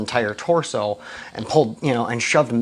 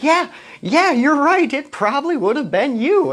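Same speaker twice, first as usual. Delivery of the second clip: high-pitched, louder mock-tickled voice; imitating amused agreement; 13 semitones higher, 4 dB louder than usual. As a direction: act out a friend cracking up in agreement. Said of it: "we both just started cracking up laughing".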